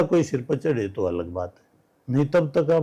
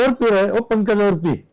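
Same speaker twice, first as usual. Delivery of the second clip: loud, higher, bluntly pressing a guest to drink; rustic loud insistence; 7 semitones higher, 5 dB louder than usual. Retold or imitated. Host urging a guest to drink more, loud and blunt. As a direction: boom it out like a rough village host. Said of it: "ये तो हमारा ऐसा ही है".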